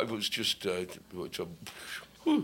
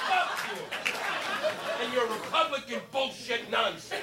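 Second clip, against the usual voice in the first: squeaky high-pitched cursing, far higher; mock rage; 8 semitones higher than usual. Comic shrieking at Republicans, high-pitched mock rage. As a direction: shriek the curse up at them.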